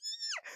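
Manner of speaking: high squealing laugh; excited laugh. Real, not acted. Vlogger laughing with delight about her favorite scene, squealing high. Laughs after hyping the part.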